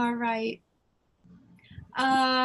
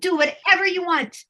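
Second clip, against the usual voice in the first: higher, louder, giddy fairy cheer; cheering fairy guides; 7 semitones higher, 7 dB louder, faster than usual. Acted. Fairy guides urging Leah to take action, loud and giddy. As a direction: hype her up like excited fairy cheerleaders.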